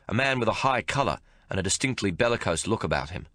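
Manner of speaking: plain reading voice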